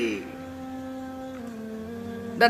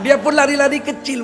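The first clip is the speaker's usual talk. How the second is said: usual speaking voice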